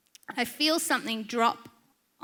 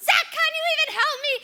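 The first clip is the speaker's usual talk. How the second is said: high, loud, fast yell for help; panicked plea; 5 dB louder, much faster than usual. Retold or imitated. Wife begs seated husband for help with lizard, frantic yell. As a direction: re-enact a frantic, exasperated wife.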